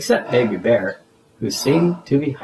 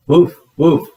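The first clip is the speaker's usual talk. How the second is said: loud, higher woof woof; Barkley the dog answering Zoe with loud barks; dog barking; 4 semitones higher, 8 dB louder than usual. Acted. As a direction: bark like a happy dog. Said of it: "Barkley answered".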